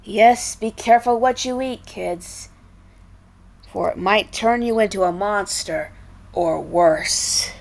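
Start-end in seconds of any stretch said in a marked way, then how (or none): none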